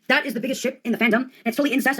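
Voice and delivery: ordinary talk, nothing marked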